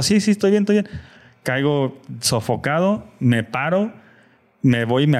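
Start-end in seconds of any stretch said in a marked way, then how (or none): 0.0-0.9 high-pitched retold brushing-off; lightly acted quick insistence that he's fine; hurried insistence. Winded rider insisting to friends he's fine, hurried. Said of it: "Y yo".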